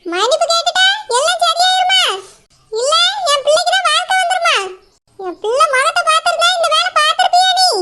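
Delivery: high, mocking scold of the runaway groom; angry older woman. Mother scolding the groom who ruined her daughter, furious and mocking. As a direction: tear into him like an outraged mother.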